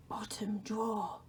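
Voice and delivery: a soft, unvoiced whisper; the Dark's whisper. The Dark calls to Laszlo in a whisper. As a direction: breathe his name from the shadows.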